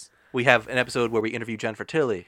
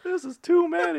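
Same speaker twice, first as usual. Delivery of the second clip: much higher squeaky joke voice; put-on high voice. Joke bit voice.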